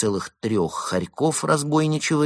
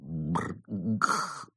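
grumbling growl; 6 dB quieter than usual. A narrator's reading. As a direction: grumble under the breath. Evgeny's grumbling noise.

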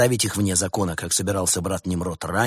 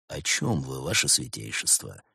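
low, quiet voice; 8 semitones lower, 7 dB quieter than usual. A narrator's reading. Bars answers rival on phone.

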